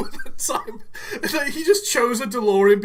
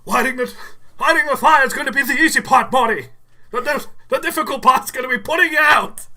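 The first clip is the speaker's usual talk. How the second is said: hammy inventor explaining the plan; Doc Brown; 3 semitones higher, 5 dB louder, faster than usual. Acted. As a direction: call it out fast like an excitable inventor. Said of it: "He's just like".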